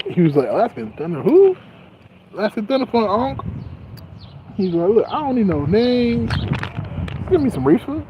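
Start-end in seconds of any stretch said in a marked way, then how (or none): 0.5-3.4 higher, excited retold voice; mimicking a friend. Vlogger retelling his friend's boast about weed, higher mock voice. 4.9-8.0 mock uncle voice.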